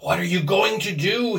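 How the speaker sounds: strained hoarse question; hoarse whisper. A narrator's reading. Husband holding his wife back, hoarsely urgent. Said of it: "he whispered hoarsely".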